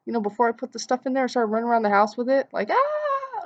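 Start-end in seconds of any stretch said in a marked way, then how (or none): 2.7-3.4 mock shriek. Running around with the bowl.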